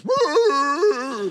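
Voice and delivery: high loud mock dog bawl in a put-on voice; dog howling along. The sheepdog sticks her head out and howls too. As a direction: howl like the dog echoing him.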